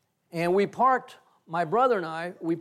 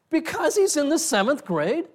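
incredulous question; 10 semitones higher, 6 dB louder than usual. Retold or imitated. Disbelief at team running away.

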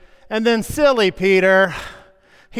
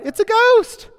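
a sudden, much higher frightened cry; terrified cry; 7 dB louder than usual. A narrator's reading. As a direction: shriek it out as if seeing a ghost. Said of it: "they cried out in fear".

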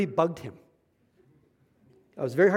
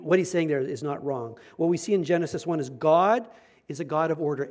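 normal talking voice

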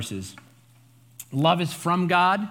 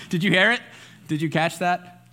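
normal talking voice

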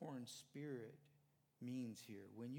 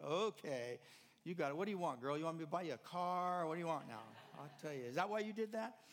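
louder, hearty grandpa banter; playful teasing; 4 semitones higher, 7 dB louder than usual. Retold or imitated. Grandpa teasing his granddaughter about a car, loud and playful. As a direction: tease her boisterously.

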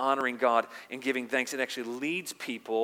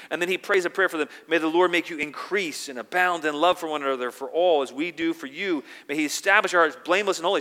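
loud, high-pitched preaching of scripture; full-force preaching; 5 semitones higher, 10 dB louder than usual. Acted. Pastor reading Paul's prayers to the church, loud preaching. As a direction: proclaim the verses to the congregation.

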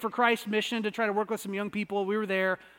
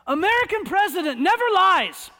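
loud, higher, wholehearted declaration; earnest old man; 8 semitones higher, 6 dB louder than usual. Retold or imitated. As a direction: proclaim it with naive conviction, up high. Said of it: "And then he said this".

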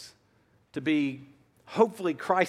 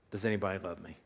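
lonely quiet; 10 semitones lower, 9 dB quieter, much faster than usual. Pretend. Imagined lonely adult child.

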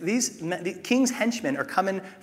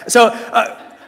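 laughing; 6 semitones higher, 12 dB louder than usual. Real, not acted. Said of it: "shut up".

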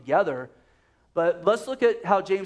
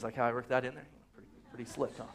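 much softer, lower self-congratulating murmur; quiet smug aside; 5 semitones lower, 10 dB quieter, much faster than usual. Real, not acted. Preacher pleased with his LeBron pun, quiet smug aside. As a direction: sneak it in like a private joke to the crowd.

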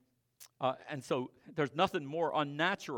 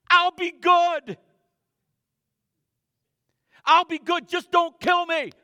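very high, very loud, slow desperate wail; the old man in us crying out as he is crucified; old man pleading for his life; 17 dB louder, slower than usual. Acted. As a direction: beg not to be killed, stretching the words. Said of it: "He's crying out".

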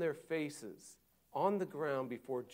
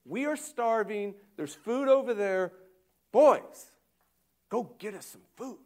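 mock Jacob; 6 semitones higher, 8 dB louder than usual. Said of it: "It's like".

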